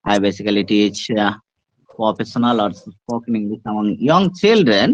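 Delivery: a teacher demonstrating a polished self-introduction; model presentation. Shown as a demonstration. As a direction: present yourself slowly and formally to the class. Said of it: "আমি presentation দিয়ে দিচ্ছি".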